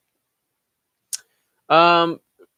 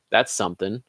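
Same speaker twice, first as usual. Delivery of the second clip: ordinary delivery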